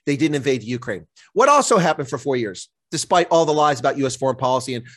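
ordinary delivery

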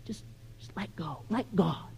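very soft, airy near-whisper; mock-nice platitude. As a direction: murmur it like a soothing, too-easy slogan. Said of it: "That sounds nice, isn't it? Just nice".

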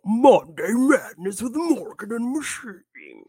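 slow, booming monster truck announcer. Acted. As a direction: boom it out like a monster truck rally ad. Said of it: "your monster truck voice".

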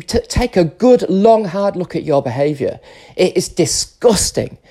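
high-pitched, building angry scolding; disgusted rant. Real, not acted. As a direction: berate them with rising outrage. Angry rant berating men.

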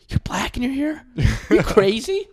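high-pitched disbelieving question; exaggerated are-you-nuts astonishment; acted incredulity. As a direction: squeak it out in mock disbelief. Acts out his incredulous question.

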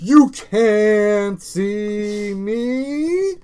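slow, drawn-out catchphrase; John Cena catchphrase. Retold or imitated. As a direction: stretch it out like a wrestler. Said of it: "like John Cena".

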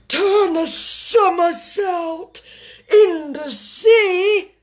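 slow, high, loud call; exuberant cry. A narrator's reading. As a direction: cry out with long stretched words. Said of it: "cried the Mock Turtle".

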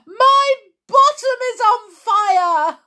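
high, loud wail; panicked yell. A narrator's reading. Boy on hot banister, panicked yell. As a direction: yell it in burning pain. Boy yells while sliding.

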